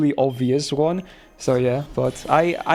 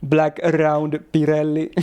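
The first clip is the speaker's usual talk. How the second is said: slow, heavily rolled R's in a mock Finnish rally-driver accent; lightly hammed-up Finnish rally English, slow; thick Finnish accent; slower than usual. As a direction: imitate the Finnish driver, rolling every R. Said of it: "we like to roll the R's a lot".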